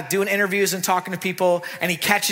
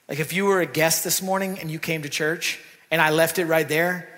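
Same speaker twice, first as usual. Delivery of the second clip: ordinary delivery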